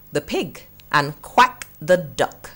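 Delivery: ordinary delivery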